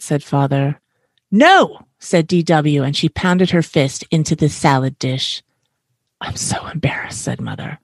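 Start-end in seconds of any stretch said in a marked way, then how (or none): 1.3-1.9 high, loud storybook "no"; defiant refusal. A narrator's reading. Picky child refusing the salad, defiant shout. 6.2-7.2 hushed, mortified whisper; embarrassed whisper. A narrator's reading. Mother ashamed at the restaurant table, embarrassed whisper.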